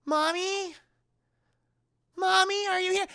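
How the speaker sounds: put-on high-pitched lost little girl; Charlie. Possessed mother speaking as daughter, high child voice. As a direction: call out in a small child's high voice. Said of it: "And it's the daughter".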